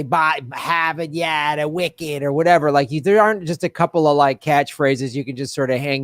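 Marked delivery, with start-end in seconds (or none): 0.0-2.2 put-on slow, drawn-out Boston accent; a light take on a Boston accent, stretched slow. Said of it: "you know, like Boston, you say".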